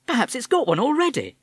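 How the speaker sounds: cheerful forced brightness; bright. A narrator's reading. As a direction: brightly cover the awkward moment. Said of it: "she said brightly".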